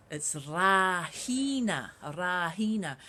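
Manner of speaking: Rahina said slowly and carefully; Māori day name. Shown as a demonstration. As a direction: spell out Rahina syllable by syllable, slowly. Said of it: "I'll give you a clue".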